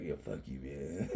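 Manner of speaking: low, soft, offhand jab; quiet stand-in for affection; affectionate insult. Acted. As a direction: mumble it fondly with a chuckle. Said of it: "That's what that means".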